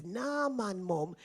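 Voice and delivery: a light imitation of her teenage son asking; quoting her son. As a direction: lightly voice her son's innocent question. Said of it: "he says".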